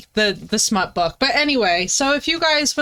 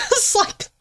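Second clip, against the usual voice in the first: high squeal of laughter; cracking up; laughing; 11 semitones higher than usual. Real, not acted.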